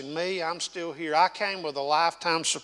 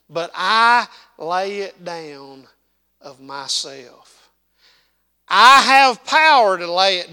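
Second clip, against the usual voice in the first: slow, louder scripture reading with weight on I; preached scripture; 3 semitones higher, 9 dB louder than usual. Acted. Preacher reading John ten aloud, slow and proclaiming. Quoting Jesus with emphasis.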